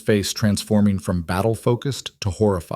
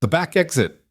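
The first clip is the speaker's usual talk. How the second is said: raised urgent shout; shouting over chaos; 8 semitones higher than usual. A narrator's reading. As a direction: call it out over gunfire. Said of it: "Lorenzo shouted over the chaos".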